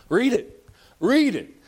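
loud, high commanding preacher cry; preaching shout. Real, not acted. Preacher urging his congregation, loud and high. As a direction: bark it out like a revival preacher.

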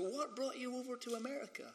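put-on high, soft American accent. Curious locals asking the Scottish newcomer a question, mock American accent. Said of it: "That's my American accent, by the way".

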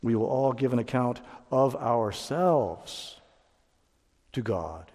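slow it down and lean on "all"; solemn, deliberate restatement; slow emphasis. Shown as a demonstration. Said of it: "Let me emphasize that".

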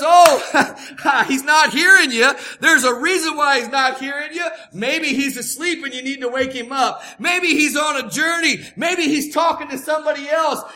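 loud laughing mockery; loud scornful taunt; mocking taunt. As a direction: laugh at the prophets of Baal. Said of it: "Elijah begins to mock them".

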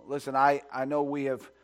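usual speaking voice

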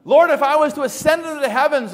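high, loud, quick psalm quotation; impassioned preacher quoting scripture; declaimed scripture. Acted. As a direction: proclaim the psalm from the pulpit.

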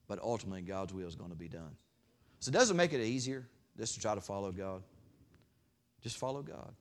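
ordinary talk

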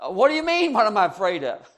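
high, loud disbelieving cry; incredulous retort. Pretend. Preacher in the sinking boat answers Jesus, incredulous. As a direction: throw the question back in disbelief.

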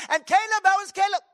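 very high, loud, eager question; Brother Vic. Retold or imitated. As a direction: imitate an effervescent pastor shouting his question. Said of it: "he talked like that".

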